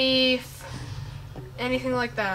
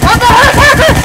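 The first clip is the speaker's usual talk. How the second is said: very high, loud baffled cry; shocked outburst; 11 semitones higher, 13 dB louder than usual. Real, not acted. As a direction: blurt it out in disbelief. Shocked by a sudden race result.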